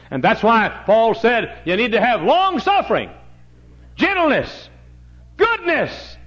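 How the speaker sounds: high, loud drawn-out pulpit cry; full-force preaching. Acted. Preacher lifting up Paul's virtues to the congregation, high and loud. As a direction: ring out each virtue.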